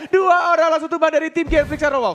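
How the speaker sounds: high, loud hype casting; excited play-by-play. Real, not acted. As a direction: shout the fight call at full energy. Hype caster during team fight. (komentator heboh berteriak)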